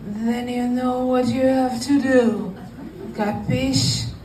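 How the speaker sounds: slow, mock-menacing Sicilian mobster accent. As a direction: lay on the godfather accent, laughing. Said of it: "Italian slash Sicilian accent".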